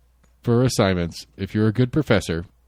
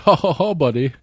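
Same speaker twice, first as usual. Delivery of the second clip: mock laugh; 6 semitones higher than usual.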